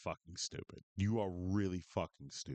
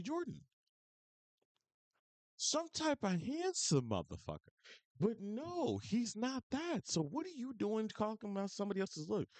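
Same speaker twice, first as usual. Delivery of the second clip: mocking, incredulous rant pitched much higher; incredulous mockery; 11 semitones higher than usual. Real, not acted. Streamer mocking Aiden Ross's looks, incredulous high-pitched rant. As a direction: scoff at his ego in a disbelieving high voice.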